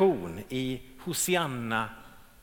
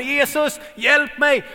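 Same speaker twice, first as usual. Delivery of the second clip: calling out; 8 semitones higher, 6 dB louder, slower than usual. Retold or imitated. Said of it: "och ropade".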